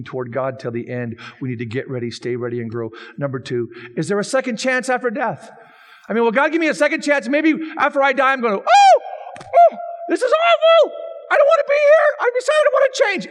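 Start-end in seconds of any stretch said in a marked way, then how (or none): 4.0-8.6 louder, higher, driving sermon voice; full-force preaching. Acted. Pastor pressing the congregation, preaching hard. 8.6-12.2 much higher, louder, whiny wail; pleading dead soul. Acted. A lost soul after death, begging in a high whine. 12.2-13.3 pleading soul. Acted. Dead person begging after death.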